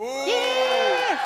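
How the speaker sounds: loud high cheer; excited cheer. Real, not acted. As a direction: cheer it out big. Cheering for Sailor Moon.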